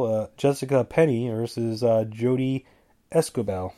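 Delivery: usual speaking voice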